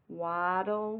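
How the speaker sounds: ordinary talk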